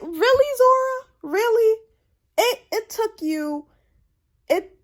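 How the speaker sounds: outraged squeal of disbelief; exasperated disbelief. Retold or imitated. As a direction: call her out, high and incredulous. Exasperated reaction to Zora.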